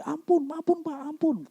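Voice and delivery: high, hammed-up plea for mercy; mock begging. Fleeing robber begging mercy from God, mock panic. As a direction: beg in a high, frightened voice.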